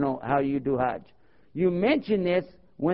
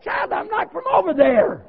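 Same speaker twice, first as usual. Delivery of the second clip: very high, loud mock protest; imitated elder lady; 6 dB louder than usual. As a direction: cry it out as an indignant elder lady. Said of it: "She said".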